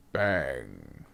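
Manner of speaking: low, soft, drawn-out gunshot word; spoken gunshot. Acted. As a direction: drop the sound effect quietly and deep. Imitates gunshot in the story.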